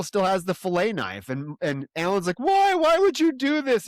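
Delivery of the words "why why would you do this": high, loud whining protest; whiny Alan. Retold or imitated. Recapping Alan protesting to the bullies, high whine. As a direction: whine it out like a wronged kid.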